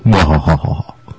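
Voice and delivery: drawn-out low theatrical "wahh"; put-on ominous wail; mock villain cry. Mock villain noise after the introduction.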